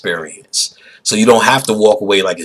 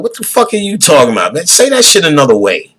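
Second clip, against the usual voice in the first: louder, higher, fast exasperation; exasperated retort; 7 semitones higher, 4 dB louder, faster than usual. Retold or imitated. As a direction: tell him off, fed up. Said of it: "I'd have told that motherfucker".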